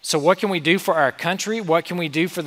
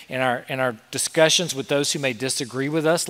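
usual speaking voice

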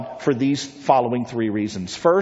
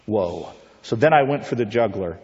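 normal talking voice

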